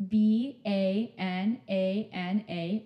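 slow, letter by letter spelling; sounding out letters. Retold or imitated. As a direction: spell it out one letter at a time. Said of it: "and then he goes".

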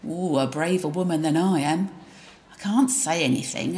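normal talking voice

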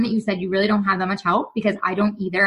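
normal talking voice

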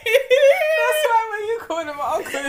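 laughing shrieks through words. Real, not acted. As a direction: squeal with laughter. Laughing story.

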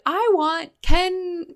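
high, drawn-out demanding wail; mock meltdown demand. Liz answering her yelling partner, mock meltdown. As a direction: stretch each word slowly, pitched high, over the top. Acts out Liz's meltdown reply.

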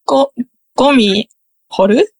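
tentative Kansai accent on ゴミ掘る; slow Kansai-style ゴミ掘る, unsure; Kansai pitch accent. Shown as a demonstration. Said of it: "教えてもらった関西弁で".

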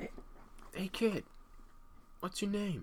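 hushed, high creepy stranger whisper. Pretend. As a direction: breathe it like a lurking creep. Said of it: "Speak up".

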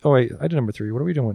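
ordinary talk

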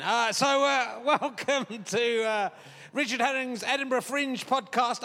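ordinary delivery

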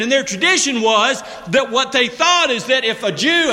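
usual speaking voice

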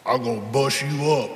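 low, menacing tough-guy growl; a hint of a big bruiser staring down his rival; Mister T threat. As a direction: lean in and threaten him, voice dropped low. Mister T threatening Rocky in ring.

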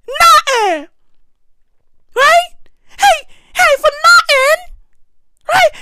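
very high, squealing disbelief; hammed-up outrage. Outraged repetition at Jada.

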